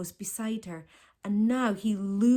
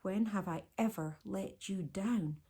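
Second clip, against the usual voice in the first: usual speaking voice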